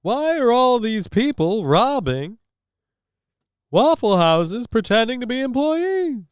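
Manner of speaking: high-pitched observational comic impression; Jerry Seinfeld. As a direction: stretch out the questions, high and incredulous. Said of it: "Jerry Seinfeld".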